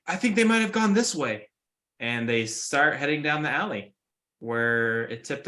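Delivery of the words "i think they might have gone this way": high, searching city guard. Acted. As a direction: call it out like a patrolling guard.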